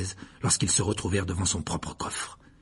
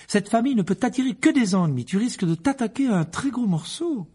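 much higher woman's voice; anxious wife, warning; 12 semitones higher than usual. Acted. Mrs Weasley warns Arthur about the Malfoys, worried. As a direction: warn your husband off a fight.

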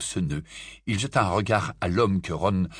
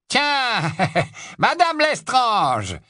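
calling out; 14 semitones higher, 4 dB louder, much slower than usual. Acted. Wizard calls out to them.

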